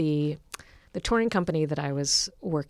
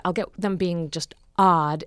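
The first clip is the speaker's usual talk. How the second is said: normal talking voice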